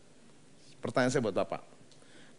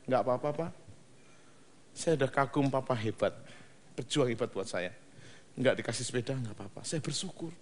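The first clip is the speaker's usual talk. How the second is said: put-on quiet grateful child; 3 semitones lower, 10 dB quieter than usual. Grateful child answering father.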